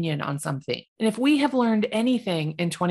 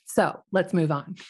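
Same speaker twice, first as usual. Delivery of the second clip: ordinary talk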